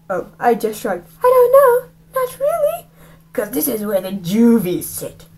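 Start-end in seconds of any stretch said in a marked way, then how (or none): 1.2-2.9 put-on high, teary-sounding boy's voice; high kid voice. Narrator voices young Rafe, high and teary. Narrator voicing himself as child. 3.3-5.3 lightly voiced bully. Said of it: "he said".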